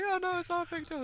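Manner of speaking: high and fast, carefree; breezy Link. Retold or imitated. Link shrugging off the statues, high and breezy. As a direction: brush it off lightly. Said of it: "she's like".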